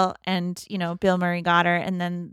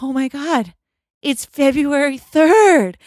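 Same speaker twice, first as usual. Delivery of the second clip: higher, louder, slow stunned exclaim; mock freaking out; 6 semitones higher, 8 dB louder than usual. Phil realizing the loop is over, high and loud. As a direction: spell out the date in disbelief. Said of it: "he's, like, freaking out".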